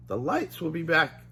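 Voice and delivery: lightly voiced Shaggy, calming; Shaggy reassuring. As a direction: tell your dog it's fine. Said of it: "Shaggy told Scooby".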